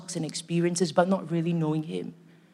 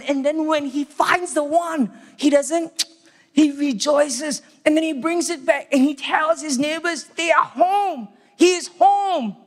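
rising joy; 7 semitones higher, slower than usual. Acted. Preacher telling of the shepherd bringing the lost sheep home, rising joy. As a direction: let the joy lift higher with every line.

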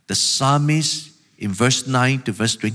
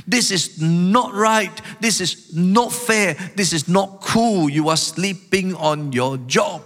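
high, emphatic indignant complaint; emotional protest; 6 semitones higher than usual. Acted. Psalmist charging God with neglect, emotional protest. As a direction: hammer each accusation slowly, pitched high. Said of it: "Can you feel the emotional tone?".

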